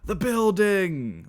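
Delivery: very high, drawn-out roar; roared toast. Acted. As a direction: roar it back like a whole table. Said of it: "we roared in one voice".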